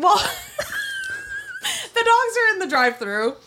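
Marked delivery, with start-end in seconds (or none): 0.2-1.9 sudden high squealing laugh; shrieking laugh. Real, not acted. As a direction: let out a real shriek of laughter. Bursting out laughing.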